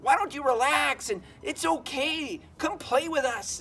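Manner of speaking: high, drawn-out enticing character voice; coaxing beavers. Acted. Beavers inviting Junior to come play, high drawn-out coaxing. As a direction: lure him away, high and stretched out.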